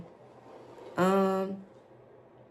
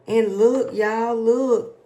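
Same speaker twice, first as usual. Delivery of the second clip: excited call; 4 semitones higher, 8 dB louder, much slower than usual. Real, not acted. Calling viewers' attention.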